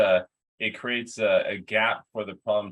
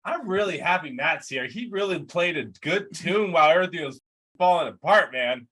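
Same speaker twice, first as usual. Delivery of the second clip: mock-grateful bandmate, higher pitched; sarcastic thanks, pitched up; 6 semitones higher than usual. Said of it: "they're like".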